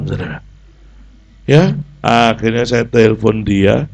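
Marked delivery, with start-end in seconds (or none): none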